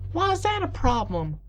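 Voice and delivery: high-pitched Southern filly; strong Southern accent. A narrator's reading. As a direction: chirp it with a Southern twang. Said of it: "with a strong southern accent".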